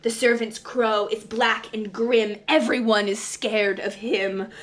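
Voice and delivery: plain reading voice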